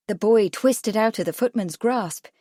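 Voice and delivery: plain reading voice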